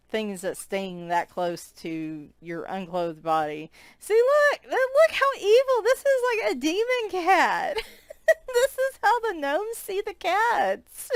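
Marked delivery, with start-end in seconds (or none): 4.0-7.8 squeaky high mock horror. Mock-spooky commentary on picture. 8.5-11.1 high squeaky voice. Acted. Joking gnome viewpoint line.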